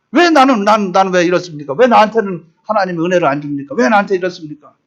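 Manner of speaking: usual speaking voice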